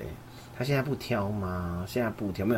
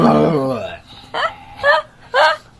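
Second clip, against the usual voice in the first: exasperated laugh-cry; 6 semitones higher, 10 dB louder than usual. Retold or imitated. Exhausted parent mock laugh.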